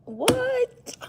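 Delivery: high, slow cutesy imitation; imitating her cute voice. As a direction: squeak it out sweetly like her. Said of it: "she was like".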